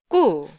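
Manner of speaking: hard Korean tense "ku". Shown as a demonstration. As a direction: pop out "ku" with a tight k. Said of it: "let's just read each sound".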